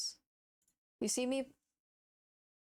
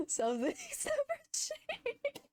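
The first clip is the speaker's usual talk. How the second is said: laughing; slower than usual. Real, not acted. Laughing at old clip.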